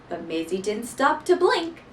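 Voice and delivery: plain reading voice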